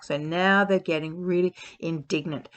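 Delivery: usual speaking voice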